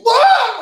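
sudden loud, very high yell; high burst of acted screaming; imitated yell. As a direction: cry out as chains burst from your wrist. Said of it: "like that".

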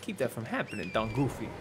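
soft, lightly voiced mobster; hushed mock-mafia menace; mock mafioso. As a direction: play a mafia don murmuring to a fellow boss. Mock mafia don role-play to Goofy.

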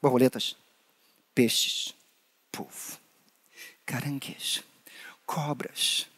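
hushed whisper of the animal names; soft whispered recap; whispered list. Real, not acted.

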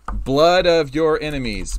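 loud, slow epic title read; booming dramatic. Shown as a demonstration. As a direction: boom it out like a movie title. Reads name twice, first booming.

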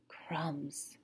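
voiceless reverent whisper; breathed reverently. Acted. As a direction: breathe it out in awe. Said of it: "Patrick breathed reverently".

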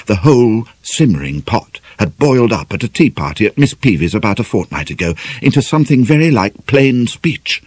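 usual speaking voice

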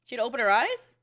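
usual speaking voice

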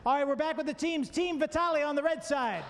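big game-show host call; game show announcer. Acted.

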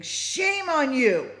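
drawn-out high yell of rebuke; retold yell. Yelling at the young men beating a homeless man, loud. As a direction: shout it slowly down at them, high and loud. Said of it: "I started yelling at them".

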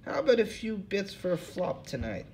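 put-on old hobo, voice pitched well up. Hobo Lou Jenkins to the interviewers, in a high put-on voice. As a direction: play the suspicious bum, pitched high.

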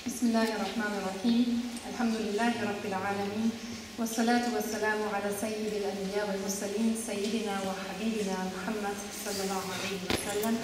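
fluent formulaic blessing in Arabic; quietly reverent Arabic prayer formula; Arabic opening invocation. Real, not acted. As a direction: recite the customary invocation in an even, understated voice. Opens lecture with Arabic invocation.

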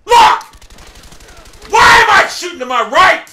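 high, loud swearing; furious shout. Real, not acted. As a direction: yell at the game in fury. Furious at the game misfiring.

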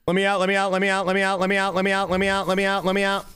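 panicked pleading. Real, not acted. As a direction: repeat it nonstop like someone trapped. Trapped as owners come home.

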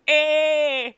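high, loud drawn-out cry; hammed-up scream to the heavens; mock scream. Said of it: "with a scream of the gods".

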